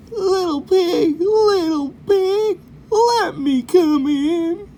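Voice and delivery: put-on high, slow, whining sick old coyote; weak coyote begs the javelinas to let him in, high and whining. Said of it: "we are going to be a sick, a sick coyote".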